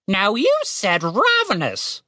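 high, slow squawk, short-a "ravenous"; correcting raven. A narrator's reading. Raven quotes Tim's mistake, squawking short-a "ravenous". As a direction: mimic the wrong "ravenous" in bird voice. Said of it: "the bird corrected".

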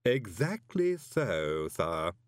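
slow, deadpan deference; measured butler, dropping on the last word; impassive Igor servant. A narrator's reading. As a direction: agree without a trace of feeling. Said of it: "said Igor impassively".

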